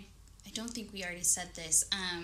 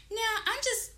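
mock refusal; 12 semitones higher, 4 dB louder than usual. Voicing her refusal to push.

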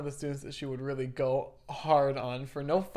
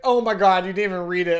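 loud, higher mock outburst of exasperation; scolding teacher; 4 semitones higher, 11 dB louder than usual. English teacher catching a student who supposedly skipped the book, scolding. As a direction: pounce on it like an accusing teacher.

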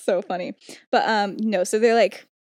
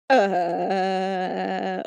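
long drawn-out stalling "uh"; mock dumbfounded; 3 semitones lower than usual. Lily, mouth open after his question, acted stunned stalling. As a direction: imitate being speechless, holding one note. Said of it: "like, her mouth, she open".

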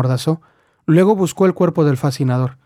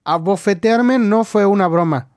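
higher, slow indignant protest; mildly voiced angry yell; 5 semitones higher than usual. A narrator's reading. Lil protests to George about the slap, higher and indignant. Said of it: "gritó ella".

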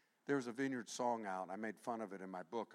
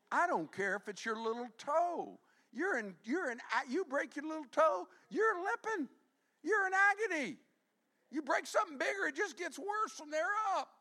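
high, loud impassioned preaching; preaching at full force; 10 dB louder than usual. Acted. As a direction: drive it home with fire.

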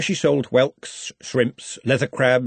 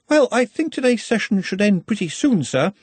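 higher-pitched, politely suggesting voice; deferential subordinate; 9 semitones higher than usual. A narrator's reading. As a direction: raise the pitch and address a superior.

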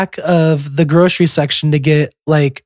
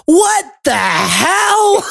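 put-on high, slow, frustrated wail of an old lady; frustrated cry; 13 semitones higher, much slower than usual. Imitating a frustrated shopper lost in Walmart, high wailing cry. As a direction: stretch it out in a fed-up high cry. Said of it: "the most frustrated tone".

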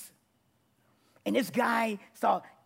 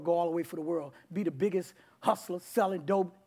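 ordinary talk, nothing marked

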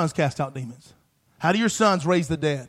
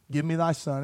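calm quiet reply; 4 semitones lower, 6 dB quieter than usual. Acted. Elijah answering, read aloud.

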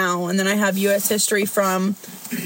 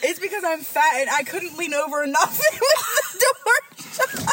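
laughing defensively; 7 semitones higher than usual. Real, not acted. Defending herself while laughing.

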